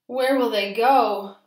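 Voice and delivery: loud. A narrator's reading. Lorax lamenting, loud and slow.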